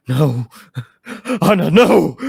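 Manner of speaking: loud, high anguished scream. Acted. Chief screaming to his wife as the wave rises, anguished scream. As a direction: scream her name as she stays behind. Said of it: "screams out".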